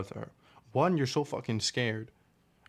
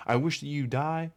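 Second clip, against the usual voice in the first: pitched-up, hammed-up insult; mock yell at her; 5 semitones higher than usual. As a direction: fake an angry outburst at the ex. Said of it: "Am I gonna yell at her".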